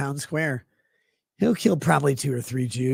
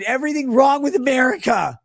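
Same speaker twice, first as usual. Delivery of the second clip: higher, louder accusing yell; mock shout; 7 semitones higher, 4 dB louder than usual. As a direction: blast the blame at him. Ranting at Jim.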